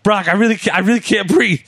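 high, strained mock panic, gasping for air; panicked plea. Retelling Paul choked by Brock, high panicked plea. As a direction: imitate a choked man begging to be let go. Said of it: "he sounded very panicked".

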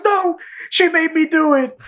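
very high, frantic protest; mock panicked excuse. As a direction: plead innocence in hammed-up panic. Retold panicked excuse after maiming.

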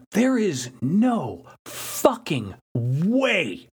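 every word of "no fucking way" stretched out slowly; very slow, swooping stress on "no fucking way"; emphatic refusal. Real, not acted. Emphatic refusal to climb down cables.

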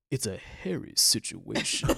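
slow, low spoken-word voice; deep announcer voice. Retold or imitated. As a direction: drawl it like a radio DJ. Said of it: "spoken word in the background from the guy".

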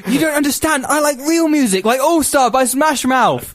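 put-on high, laughing whiny teen; whiny teenager. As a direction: pout like a moody teen ogre. Shrek as edgy teen.

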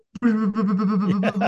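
high, rapid-fire "brrr" babble; fast-talk babble. Retold or imitated. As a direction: imitate a nonstop sales spiel as pure noise. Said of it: "talking real fast about real estate".

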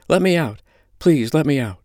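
high-pitched, urgent begging; panicked pleading. Acted. Holly begs out of the straps, panicked. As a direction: beg to be let out, panic rising.

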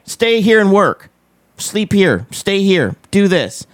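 usual speaking voice

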